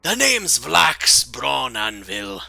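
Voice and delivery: slow, loud, proud introduction in a put-on accent; Vlax. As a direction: announce yourself boldly in character. Possessing character introduces himself.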